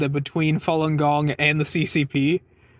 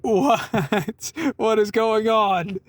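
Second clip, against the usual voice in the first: bewildered laugh; 4 semitones higher, much slower than usual. Real, not acted. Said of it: "I'm just stunned".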